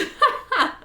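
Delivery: squealing laugh up high; high burst of laughter. Real, not acted. Laughing after cursing English, squealing laugh. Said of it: "is so fucked".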